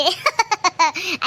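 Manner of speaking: laughter after a love vow; gloating laugh. Acted. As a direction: laugh long and pleased with the romance. Lovers gloating over their love.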